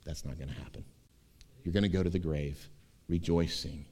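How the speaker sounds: quiet, gentle reassuring; calm his fear softly; God reassuring Jacob, softly; soft reassurance. Acted.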